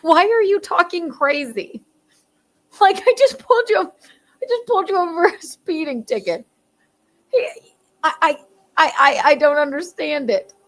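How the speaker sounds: high-pitched, stammering cop; baffled cop. Acted. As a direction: play a cop who can't make sense of it. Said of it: "Now the cop is gonna go".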